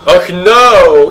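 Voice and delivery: normal talking voice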